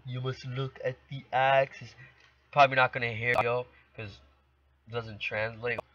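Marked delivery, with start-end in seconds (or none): 0.1-1.9 imitating an African accent. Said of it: "you can do the African accent too".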